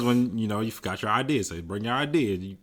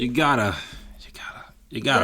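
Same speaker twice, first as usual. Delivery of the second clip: teasing whisper; faster than usual. Real, not acted. Teasing hesitation.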